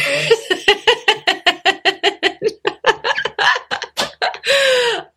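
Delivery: a long, high bright laugh that falls away; warm laugh. Real, not acted. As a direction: laugh at your own little joke. Laughs after own joke.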